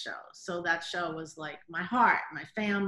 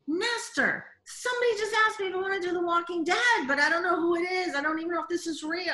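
panicked high voice; 10 semitones higher, 4 dB louder, faster than usual. Retold or imitated. Panicked retelling of text to husband.

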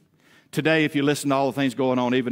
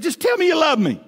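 high whiny plea; 7 dB louder than usual. Shown as a demonstration. Husband begging his wife in bed for reassurance, high whiny plea. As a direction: squeak it out like a needy kid begging. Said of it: "the first time I ever did".

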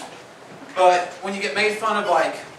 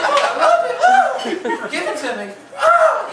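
high, loud falsetto moans; mock moaning in a squeaky woman's voice; mock moaning wife; 5 dB louder than usual. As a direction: play a hammed-up moaning woman. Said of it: "She be like".

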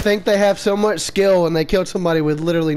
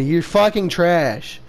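usual speaking voice

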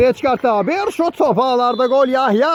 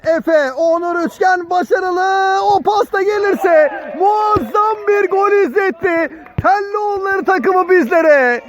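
high, slow, shouting the goal build-up; excited commentator shout; 7 semitones higher than usual. Real, not acted. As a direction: call it like a stadium announcer.

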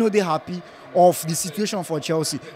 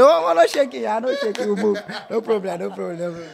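laughing reassurance; 3 semitones higher than usual. Real, not acted.

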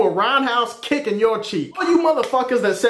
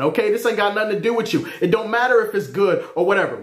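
ordinary delivery

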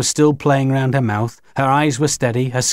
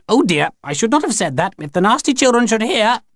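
put-on high, precise old-lady alien; sharp, clear Grinny; 11 semitones higher than usual. A narrator's reading. Grinny regrets her slip before the children, sharp and clear. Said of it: "she said very sharply and clearly".

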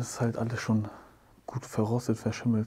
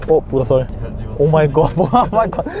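laughing; 4 semitones higher, 12 dB louder than usual. Real, not acted. Laughing at friend's photo.

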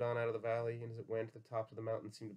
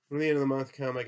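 much louder, higher restart; loud recital; 4 semitones higher, 12 dB louder than usual. A narrator's reading. George taking up his old speech for Lenny, loudly. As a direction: launch into it at full volume, pitched up.

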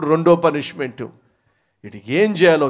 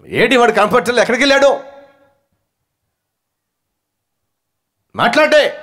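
angry shout; 9 semitones higher, 7 dB louder than usual. Acted. Father shouting for the boy.

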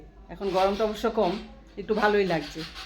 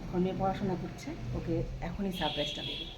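speaking softly; 6 dB quieter than usual. Real, not acted. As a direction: keep it quiet so she won't hear. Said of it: "একটু আস্তে করে কথাটা বলছি".

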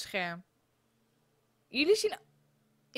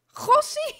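"Gossie" much higher, rising; squeaky exclamation; 12 semitones higher, 9 dB louder than usual. Real, not acted. Repeats, exaggerated.

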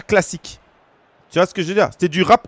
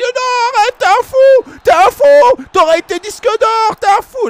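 much higher, louder excited producer; 7 dB louder, slower than usual. Retold or imitated. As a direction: shout it like a stunned producer. Producer voiced, shouting amazed.

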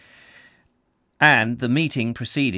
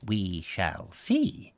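slower, softer repeat of "We shall see"; slow enigmatic echo; 2 semitones lower, 5 dB quieter, much slower than usual. A narrator's reading. Doctor repeats his reply to the matron, slow and soft. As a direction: draw "We shall see" out slowly, softly, half to yourself. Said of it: "Doctor Trump replied enigmatically".

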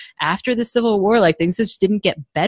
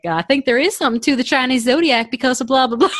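put-on Southern accent; 7 semitones higher, 3 dB louder than usual. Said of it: "a Southern lady".

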